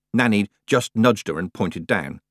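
ordinary delivery

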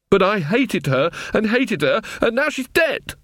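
high, slow anguished outburst; upset, climbing to a high pitch; distraught confession. Acted. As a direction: blurt out the guilt, voice rising sharply. Distressed confession about the dead woman.

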